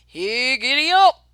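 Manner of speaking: high, loud call to the horses; giddy up, loud and high; driver's cry. Acted. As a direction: yell it to get the horses going. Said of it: "cried the driver".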